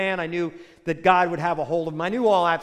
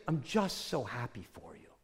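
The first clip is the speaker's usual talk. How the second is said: soft, much lower, hushed I'm just so happy for you; tender; 10 semitones lower, 12 dB quieter than usual. Retold or imitated. Old family friend blessing the young seminarian, soft and low. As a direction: say it gently, almost under the breath. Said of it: "he goes".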